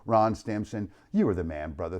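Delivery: ordinary talk, nothing marked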